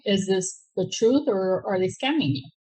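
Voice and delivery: normal talking voice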